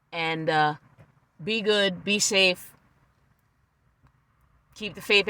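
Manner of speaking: soft, low, fond farewell; a quiet loving goodbye; tender sign-off. Real, not acted. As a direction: sign off gently to the viewers.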